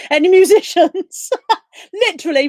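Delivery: laughing, high and loud; giggling embarrassed admission. Real, not acted. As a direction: laugh through the words at a blank mind. Laughs at own blank mind.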